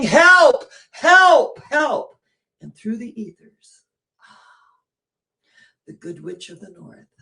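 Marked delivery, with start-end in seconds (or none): 0.0-2.2 high, loud mock cries for help; hammed-up shout of help, fading on the last; calling for help. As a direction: call out to rouse the sleepers.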